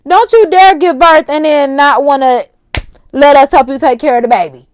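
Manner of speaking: telling Krishan off, playful loud scold; mock scolding warning. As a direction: warn her not to dare, half joking. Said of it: "That's why I'm like".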